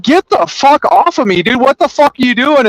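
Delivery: high-pitched retold outburst; worked-up, high-voiced disbelief; agitated protest. Retold struggle to break free.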